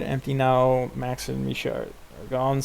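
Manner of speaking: ordinary delivery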